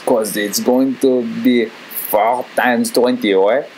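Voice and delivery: slow, halting cartoon French accent; old-time French dude. Old-time Frenchman inventing numerals, slow pondering French accent. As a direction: reason it out slowly with a thick French accent. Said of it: "some old-time French dude was like".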